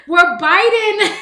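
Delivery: bursting into loud laughter mid-sentence; can't stop laughing at the twist. Real, not acted. Laughing as she tells the caller the punchline.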